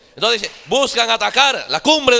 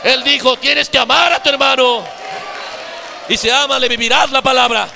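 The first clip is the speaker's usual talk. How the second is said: louder, higher, hammer each phrase home; full-force sermon shout; preaching at full force; 3 semitones higher, 3 dB louder than usual. Acted. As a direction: thunder it from the pulpit.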